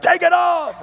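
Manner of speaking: screamed high and loud; furious yell. Retold or imitated. Preacher yelling at masked man, furious shout. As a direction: bellow it at him. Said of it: "I just yelled".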